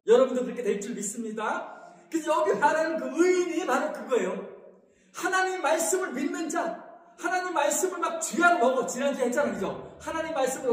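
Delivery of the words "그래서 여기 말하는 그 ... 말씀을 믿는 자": ordinary talk, nothing marked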